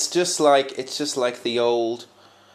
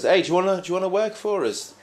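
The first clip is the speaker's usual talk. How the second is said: high, cheery call across the square; farmer calling out; 9 semitones higher, 3 dB louder, faster than usual. Retold or imitated. Farmer hailing a worker in the town square, high cheery call. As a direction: hail a stranger, upbeat and lifted.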